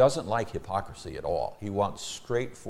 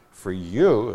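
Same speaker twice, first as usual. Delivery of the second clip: YOU punched out loud; stress on YOU; 2 semitones higher, 8 dB louder than usual. Acted. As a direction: hit the word "you" hard. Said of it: "emphatic pronoun".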